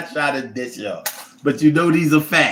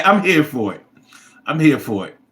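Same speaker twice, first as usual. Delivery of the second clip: ordinary talk, nothing marked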